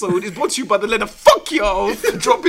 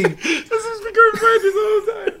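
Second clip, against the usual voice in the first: laughing hard; 13 semitones higher, 4 dB louder, slower than usual. Real, not acted.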